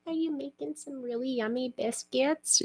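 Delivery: tiny high voice to a beloved cat; pet talk. Retold or imitated. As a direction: ask it like talking to a baby. Quoting herself cooing to cat.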